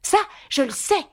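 high, lively exclamation; eager cry. A narrator's reading. Alice pleased to know the answer, high eager cry. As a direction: jump in high, keen to answer. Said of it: "s'écria vivement Alice".

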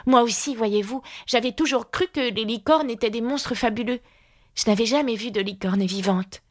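high-pitched Alice voice; polite little girl. A narrator's reading. Alice answering the unicorn, polite and high. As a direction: voice the girl well above the narration.

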